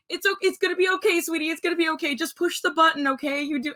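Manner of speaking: put-on calm a scared child down; imitating soothing dad. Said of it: "he's, like, calming me down".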